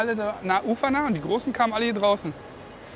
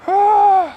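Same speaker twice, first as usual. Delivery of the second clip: high loud yelp of surprise; excited yelp; 14 semitones higher, 12 dB louder than usual. Real, not acted. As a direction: yelp out at the strike. Sudden strike on line.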